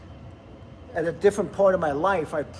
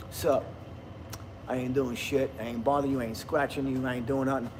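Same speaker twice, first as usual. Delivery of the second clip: low, soft, couldn't-care-less cat; lazy cat voice; 5 semitones lower, 6 dB quieter than usual. Retold or imitated. Owner voicing his chill cat, low and soft and lazy. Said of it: "a cat is just like".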